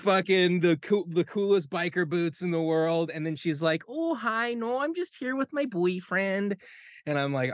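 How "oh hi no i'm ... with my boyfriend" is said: high, slowed northern Minnesotan mom voice; Minnesotan mom accent. Retold or imitated. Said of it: "the most fucking northern Minnesotan mom".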